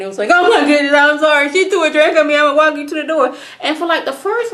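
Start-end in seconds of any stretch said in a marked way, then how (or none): 0.3-3.3 mocking fake sob, higher and louder; bad fake crying. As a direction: wail it like a terrible actress. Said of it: "when she was doing that bad fake crying".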